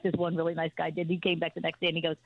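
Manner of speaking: usual speaking voice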